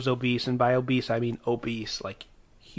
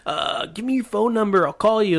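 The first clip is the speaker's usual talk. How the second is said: lightly voiced schoolmate, pitched up; mild higher-pitched imitation; imitating Dan Hogarth; 7 semitones higher than usual. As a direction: put on a higher voice for a kid asking. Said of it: "he's like".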